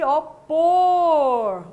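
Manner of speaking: pôr said loud and pointed; a teacher singling out the wrong word pôr; pointing out the word. Shown as a demonstration. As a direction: hit pôr hard, like pointing at the board. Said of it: "o erro está aqui".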